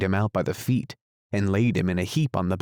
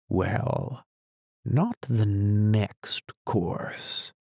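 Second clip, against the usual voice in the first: slow, measured, musing; slow and thoughtful; much slower than usual. A narrator's reading. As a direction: draw it out thinking it over. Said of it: "in a slow, thoughtful tone".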